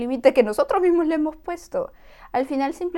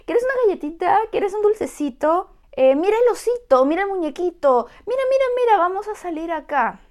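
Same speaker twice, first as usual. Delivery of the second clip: high, louder sing-song coaxing; distracting baby talk; 7 semitones higher, 5 dB louder, faster than usual. An adult distracting a crying child with toys, high sing-song. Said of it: "como si fueras un lorito".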